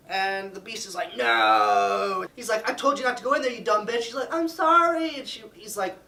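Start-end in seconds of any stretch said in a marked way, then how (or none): 1.2-2.2 loud, drawn-out roar of "no"; angry shout. Acted. Beast catches Belle in forbidden wing. 2.7-4.0 high, loud, mock-furious telling-off; angry scolding. Beast scolds Belle. 4.3-5.2 high, loud, hammed-up "sorry"; wailed apology. Belle's acted apology when scolded.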